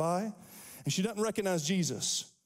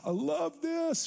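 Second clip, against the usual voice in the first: delighted; 10 semitones higher, slower than usual. Real, not acted. Preacher delighted.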